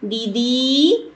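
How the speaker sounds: दीदी with the last ई stretched very long; final vowel drawn out. Shown as a demonstration. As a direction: call दीदी, pulling the end vowel out slowly. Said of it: "last वाले स्वर को हम थोड़ा सा लंबा खींच रहे हैं". (दीदी के आख़िरी ई को लंबा खींचकर पुकारना)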